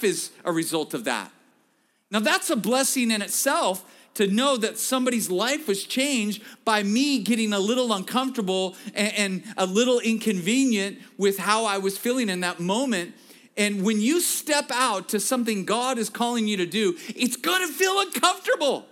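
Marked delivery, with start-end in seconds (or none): 2.1-17.0 preaching. Acted. Preacher builds to full force. 17.1-18.9 much higher, louder shouted line, stressing feel; preacher's shout. Shown as a demonstration. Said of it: "Turn to your neighbor and say".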